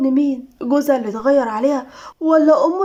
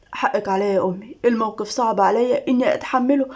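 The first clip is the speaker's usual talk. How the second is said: usual speaking voice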